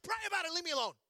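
fed up, wave him off; irritated brush-off. Retold or imitated. Frustrated dad shooing his son away. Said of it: "It's more like".

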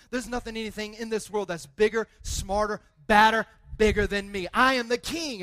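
Jesus proclaiming. Retold or imitated. Pastor voicing Jesus as king of the universe, booming. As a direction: declare it with full might. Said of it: "Jesus that comes along and says".

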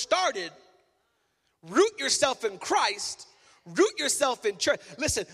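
fiery pulpit delivery; full-force preaching. Acted. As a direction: drive it home to the congregation.

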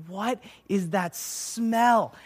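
drawn-out, incredulous disgust; mock disgust. Waking at a sleepover to a friend's stinky hair, mock disgust. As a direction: stretch it out in appalled disbelief. Retold waking to a foul smell.

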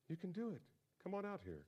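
Jesus calling Peter onto the water, soft and high; Jesus coaxing. Retold or imitated. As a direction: gently encourage him to step out. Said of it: "And Jesus says".

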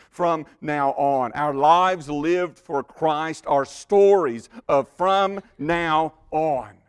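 slow and loud, stressing "from now on"; emphatic slow proclamation. Acted. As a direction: drive home "from now on" word by word. Preacher's emphatic climax.

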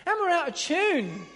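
loud, high, fervent preaching. Acted. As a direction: rouse the congregation, building up. Preaching with rising force.

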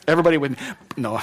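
ordinary delivery

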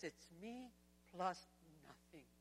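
soft, slow quote with a pause in the middle; low, deliberate ending; hushed, weighty. Acted. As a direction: let it sink in quietly. Repeats Jesus' agenda to the church.

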